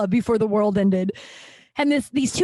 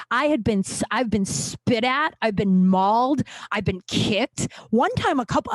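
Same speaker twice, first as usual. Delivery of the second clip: usual speaking voice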